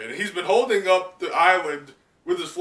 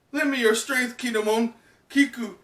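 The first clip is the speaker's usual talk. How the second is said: higher, earnest plea; pleading Momo; 9 semitones higher, 3 dB louder than usual. Acted. Momo begging his retainers for strength, high plea. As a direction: call on fallen friends for courage. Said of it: "he's thinking to himself".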